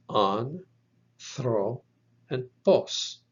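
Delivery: anthropos broken into an, thro, pos with pauses; syllable by syllable. Shown as a demonstration. Teacher showing a word's three syllables, syllable by syllable. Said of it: "it has three syllables".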